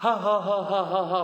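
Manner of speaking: booming hammed-up director laugh; loud mock laugh. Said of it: "kata pengarah". (ketawa besar dibuat-buat pengarah)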